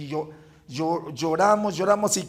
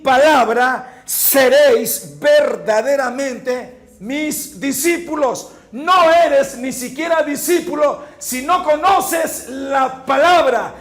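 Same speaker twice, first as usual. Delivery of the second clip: loud, slow, driving preacher's voice; fervent preaching; 4 semitones higher, 7 dB louder than usual. Acted. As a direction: press the congregation hard with every line. Preaching at full force.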